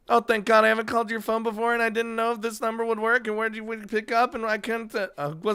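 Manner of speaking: put-on high, fast, breathless girlfriend on the phone; Emmy's voice. Panicked girlfriend calling Hisao late at night, high and rushed. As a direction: rattle it off high and panicked, then burst out.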